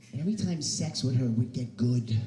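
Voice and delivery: ordinary talk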